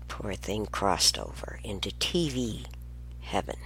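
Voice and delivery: soft whispered mock mourning; mock eulogy. Real, not acted. Grandmother mourning her broken TV, mock-solemn whisper. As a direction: hush it like a mock funeral for the TV.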